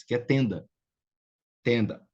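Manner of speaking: usual speaking voice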